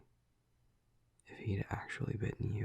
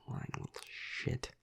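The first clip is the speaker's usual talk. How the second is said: low-volume, breathy curse; muttered contempt; 5 dB quieter than usual. Acted. Vampire cursing a lying clan juvenile, muttered contempt. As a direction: mutter it under your breath.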